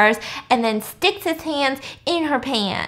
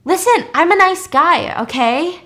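defensive nice guy; 7 semitones higher, 5 dB louder than usual. Retold or imitated. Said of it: "And so he's freaking out".

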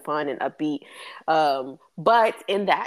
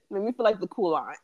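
ordinary delivery

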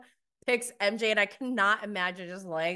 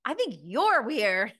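sassy comeback; 7 semitones higher, 4 dB louder than usual. Retold or imitated. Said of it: "And Larsa's like".

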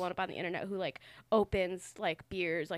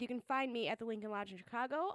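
normal talking voice

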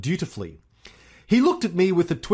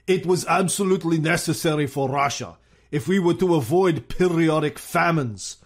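Stalin impression; 4 semitones higher than usual. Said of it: "Stalin said".

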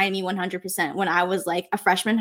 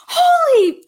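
high, loud startled yelp; surprised exclamation; 8 dB louder than usual. Retold or imitated. A stranger spots her in line and yelps in surprise. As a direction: blurt it out as if spotting a celebrity.

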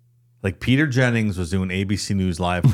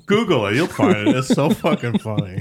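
usual speaking voice

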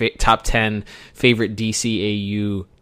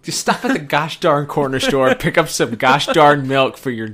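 nagging parent, raised and louder; scolding parent; 6 semitones higher, 4 dB louder than usual. Acted. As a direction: lecture him like an exasperated parent. Mock-scolding Terry as a parent.

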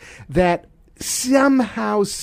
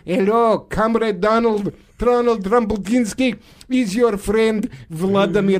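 mock Russian accent, chummy caller; Vladimir Putin. Russian leader phoning his friend Donald, mock Russian accent. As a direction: greet a comrade in a stagey Russian accent. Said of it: "I'm now talking in a Russian accent".

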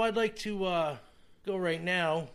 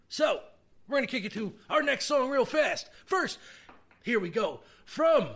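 hyped-up intro; 8 semitones higher, 5 dB louder than usual. Real, not acted. Hyped radio host announcing next song.

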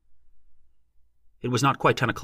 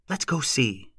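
put-on higher, eager girl; 5 semitones higher, slower than usual. A narrator's reading. Girl tugging companion's sleeve to go see, eager. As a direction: urge him along eagerly, pitch lifted. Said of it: "Lossie said eagerly".

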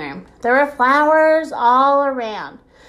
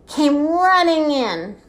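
drawn out; 5 semitones higher, slower than usual. A narrator's reading. Dramatized entrance of the manager.

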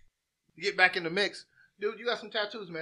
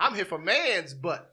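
slow mock character voice; 3 semitones higher, 7 dB louder, slower than usual. Retelling a South Park character.

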